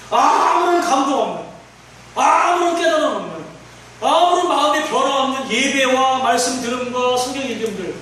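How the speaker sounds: forceful list, each opening hit high; impassioned preaching. Acted.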